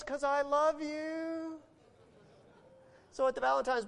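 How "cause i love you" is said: high, slow, drawn-out cooing answer; sweetly loving wife. Retold or imitated. Voicing wife's sweet answer.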